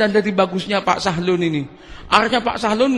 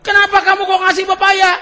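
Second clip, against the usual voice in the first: louder, higher baffled question; astonished exclaim; 9 semitones higher, 5 dB louder than usual. Retold or imitated. Pak Sahlun questioning the thief's gift, astonished and loud. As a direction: blurt out your disbelief.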